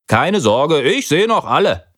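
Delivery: put-on high, falsely reassuring boy's voice; fibbing. A narrator's reading. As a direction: cover the lie lightly. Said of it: "flunkerte Frederik".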